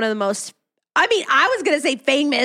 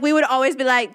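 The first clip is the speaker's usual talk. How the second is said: usual speaking voice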